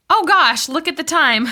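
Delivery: sudden high startled exclamation; surprised gasp. Acted. Friend at lunch startled by the clock, surprised. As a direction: jump as if noticing the late hour.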